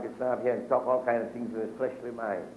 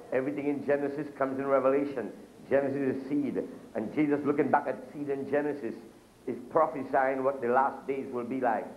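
ordinary talk

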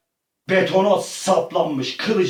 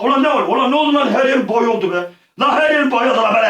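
panicked yelling; 8 semitones higher, 6 dB louder, faster than usual. Real, not acted. Reacting to gross mess.

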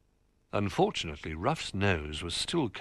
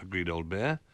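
usual speaking voice

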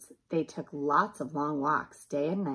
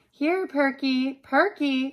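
high, loud, drawn-out call for a lost dog; slow, singing-out call; 9 semitones higher, 8 dB louder, slower than usual. A narrator's reading. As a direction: call the dog from across the yard. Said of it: "called Arthur".

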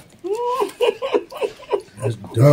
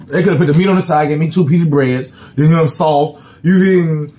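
fast, higher heated rant; excited rant; 5 semitones higher, 4 dB louder than usual. Real, not acted. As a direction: pile on the complaints quickly. Ranting about pita bread.

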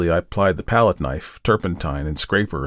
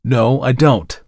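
louder, curt denial; angry; 4 semitones higher, 6 dB louder, slower than usual. A narrator's reading. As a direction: snap back irritated. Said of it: "I said angrily".

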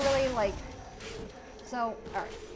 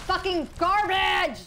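furious shout, much higher and louder; angry outburst; 11 semitones higher, 7 dB louder, slower than usual. Real, not acted. Streamer yelling at the unfair boss, furious shout. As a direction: blow up at the game in real rage.

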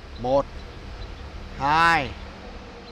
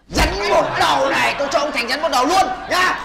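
loud, higher threat with a snap; mock angry threat at the lodger; 5 semitones higher, 5 dB louder than usual. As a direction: threaten to turn him into a snake.

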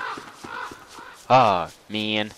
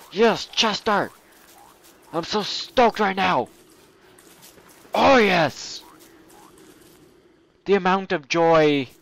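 higher, gushing with joy; overjoyed; 7 semitones higher, 4 dB louder, slower than usual. Real, not acted. Gamer thrilled at finding his pet Chester. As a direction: squeal with delight.

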